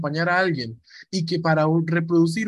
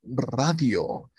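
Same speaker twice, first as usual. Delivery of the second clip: lower, mock deep radio voice; deep announcer voice; 4 semitones lower than usual. Shown as a demonstration. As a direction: drop into a hammed-up deep announcer voice. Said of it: "el hombre que habla así".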